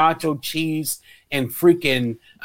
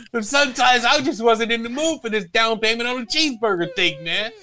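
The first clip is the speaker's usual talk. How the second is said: normal talking voice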